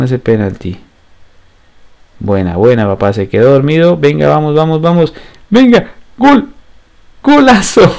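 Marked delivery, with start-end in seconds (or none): 5.5-8.0 goal shout. Real, not acted. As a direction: celebrate the goal laughing. Scores a goal, laughs.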